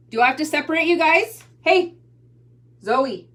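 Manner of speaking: loud high-pitched scolding. Real, not acted. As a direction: raise the voice to break up the fight. Mother scolding fighting kids.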